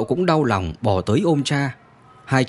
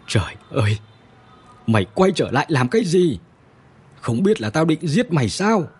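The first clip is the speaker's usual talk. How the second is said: a touch of a broken father's wail, lightly higher; 4 semitones higher than usual. A narrator's reading. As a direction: cry out at the loyal dog, grief breaking through. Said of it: "ngồi phịch xuống đất gào khóc". (người cha gào khóc nhẹ, giọng hơi cao)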